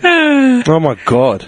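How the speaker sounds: slow, high, laughing disbelief. Real, not acted. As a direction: laugh it out slowly. Amused exasperation.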